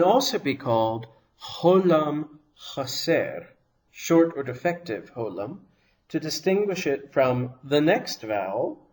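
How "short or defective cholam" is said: ordinary talk, nothing marked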